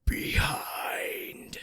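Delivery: raspy, breathy ghostly growl; deep throaty growls. Retold or imitated. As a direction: growl it out like a spirit. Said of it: "And in this deep, low, guttural growl".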